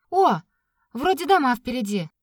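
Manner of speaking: sudden bright exclamation; surprised. Acted. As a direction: spot the houses and light up. Katya spots houses ahead.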